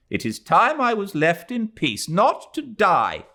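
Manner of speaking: sour, weary resentment; old lady's sour complaint to the young girl; said sourly. A narrator's reading. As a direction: sound fed up with the whole world. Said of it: "she added sourly".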